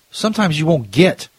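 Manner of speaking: usual speaking voice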